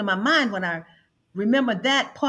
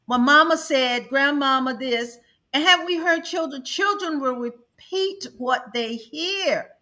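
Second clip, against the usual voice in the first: ordinary talk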